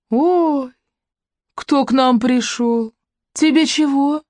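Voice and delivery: high, mocking woman's drawl, surprised. A narrator's reading. Woman at the door mockingly greets the visitor, drawling high. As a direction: stretch it out in surprised mockery. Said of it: "удивлённо и, как ему показалось, издевательски протянул".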